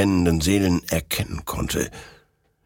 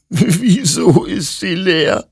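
high, slow tearful wail; acted sobbing; 12 semitones higher, 5 dB louder, slower than usual. A narrator's reading. Hector finds the rifle empty, high sobbing despair. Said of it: "schluchzte Hector".

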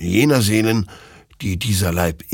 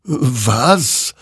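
higher, startled question; puzzled; 6 semitones higher, 3 dB louder than usual. Acted. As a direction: ask it in surprise. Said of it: "wunderte sich dieser". (verwundert nachfragend)

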